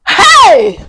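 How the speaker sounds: high, loud "Hey!"; shocked cry. Retold or imitated. Actor learning of the scam, shocked shout.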